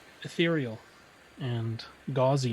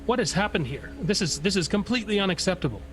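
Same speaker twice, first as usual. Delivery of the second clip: higher-pitched indignant scolding; upset; 5 semitones higher than usual. Acted. Spirit scolding the group over Clara's failings, upset. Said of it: "I'm very upset".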